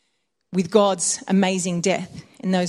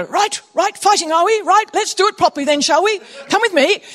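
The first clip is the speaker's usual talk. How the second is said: brisk British schoolmaster, raised and loud; British accent; 8 semitones higher, 5 dB louder than usual. Acted. Schoolmaster rounding up fighting boys, British accent. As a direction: put on a higher, louder British master's voice. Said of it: "I'm doing a British accent".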